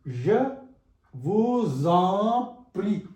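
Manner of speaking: very slow "je vous en prie", vous linked to en with a z; linked liaison. Shown as a demonstration. Teacher demonstrates linking words, slow liaison in "vous en". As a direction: draw out je vous en prie, sounding voo-zon. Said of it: "We have to link them".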